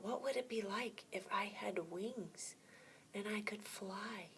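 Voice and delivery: unvoiced hushed wondering; soft whispered daydream. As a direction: breathe it like a secret thought. Fish wondering, whispered aloud.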